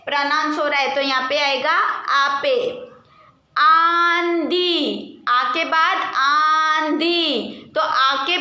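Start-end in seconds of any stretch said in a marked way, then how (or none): 3.5-7.7 आंधी stretched very slowly with a nasal आ; drawn-out demo word. Shown as a demonstration. Teacher to class, showing the nasal sound, slow आंधी. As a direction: draw out the start of आंधी. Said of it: "आ के बाद pronounce आ रहा है".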